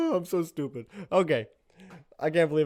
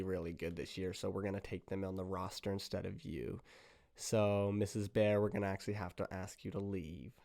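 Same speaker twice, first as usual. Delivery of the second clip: low-key, softer, lower official voice; apologetic tryout judge; 4 semitones lower, 7 dB quieter than usual. Acted. Judge asking Missus Bear to leave, quiet official. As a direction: let them down quietly and hesitantly.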